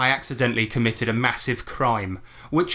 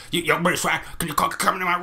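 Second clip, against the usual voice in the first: higher mock voice of an insistent guy; pestering American guy; 4 semitones higher, faster than usual. Pestering British visitors into his hotel room, imitated insistent guy. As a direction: imitate a man badgering a tourist. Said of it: "That's how he sounded as well".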